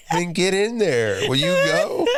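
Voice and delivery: high, laughing urgent command; laughing urgency. Retold or imitated. Dad rushes daughter to the bathroom, laughing. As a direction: shoo her off while cracking up. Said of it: "I'm like".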